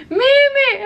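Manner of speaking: crying call. Retold or imitated. Wife reenacting calling her dog, crying call.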